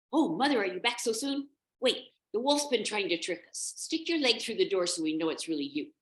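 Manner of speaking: put-on little goat kids at the door; excited kids. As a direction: call out eagerly, then warily.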